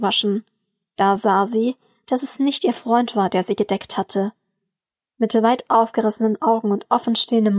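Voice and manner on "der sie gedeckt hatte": plain reading voice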